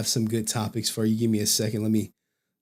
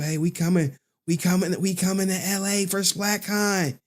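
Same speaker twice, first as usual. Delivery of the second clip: high hyped-up cheering, climbing higher; mock excited crowd; 9 semitones higher than usual. Fans heading to LA for Splat Con, mock excitement. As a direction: play the eager fans, pitch rising to a squeal.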